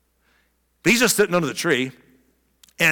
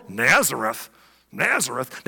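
low-pitched, unimpressed doubt; skeptical; 4 semitones lower, slower than usual. Acted. Nathanael doubting anything good from Nazareth, skeptical. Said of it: "he went from skeptical".

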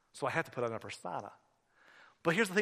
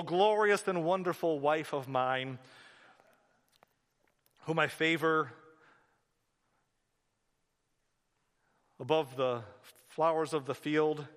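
slow, mock-poetic declamation with long halting pauses; grandiose love recitation; 4 dB louder, slower than usual. Husband answering his wife with a memorized speech, halting grandiose recitation. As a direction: plough on through the archaic verse, haltingly.

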